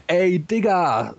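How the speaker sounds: high, drawn-out hail to a buddy; bro greeting. Acted. Marx greeting Engels with a fist bump, hyped. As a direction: stretch it out like a hyped homeboy. Brotherly greeting between pals.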